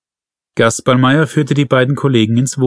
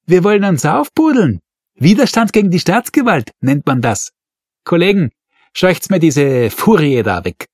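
sneering inspector; 7 semitones higher than usual. A narrator's reading. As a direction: sneer at her and order her away. Inspector sneers at furious mother.

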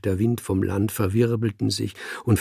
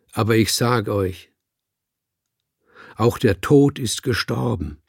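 sonorous speech. Acted. The speaker addresses the cats, sonorous and solemn. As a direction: hold the voice full and sonorous, like an orator. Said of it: "hielt meine Stimme sonor".

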